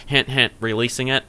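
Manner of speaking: ordinary talk, nothing marked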